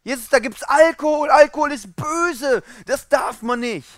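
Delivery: high, loud judgemental scolding; mock scandalised. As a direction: imitate appalled moralisers, voice pushed up high. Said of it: "wurde von denen diskriminiert. Die haben gesagt". (gespielt empörte, hohe Moralapostel-Stimme)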